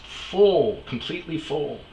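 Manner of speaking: FULL hit high and loud; emphatic full. Real, not acted. To the listener about the trash, FULL stressed high. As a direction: lean hard on full in disbelief.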